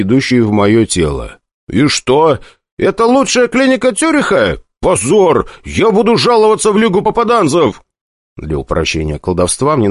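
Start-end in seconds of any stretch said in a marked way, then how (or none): 1.7-7.8 high indignant complaint. Acted. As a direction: protest shrilly at the clinic. Indignant outburst in a clinic.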